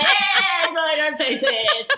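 high, loud laughing shout; drunken cheer. Retold or imitated. Drunk mates cheering the face-drawing plan, shouting and laughing. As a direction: whoop it out in drunken glee.

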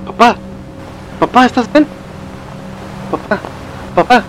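high, urgent calls, checking on him; worried calling. Acted. Child on the phone asking if father is okay, alarmed. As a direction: call out anxiously for an answer.

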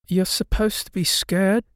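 put-on ghost girl voice.

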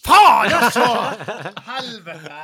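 loud, high cry of dismay; explosive real outburst of frustration; outraged swearing. Real, not acted. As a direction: curse your rotten luck out loud. Loses the draw, swearing outburst.

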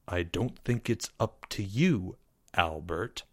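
low, soft and controlled, a teacher holding his temper; quiet. A narrator's reading. Teacher quietly warning a defiant student, low and soft. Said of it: "said Mister L quietly".

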